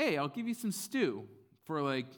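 high, loud salesman's offer; wheeling-dealing Jacob. Retold or imitated. Jacob offers his brother stew, high eager pitch. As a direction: pitch it brightly to your brother.

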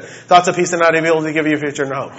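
hurried memorized recital run together; rattled off fast. Retold or imitated. Father imitating son Isaac reciting Jeremiah fast, rushed sing-song recital. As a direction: race through it like a kid showing off. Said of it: "he'll say it like that".

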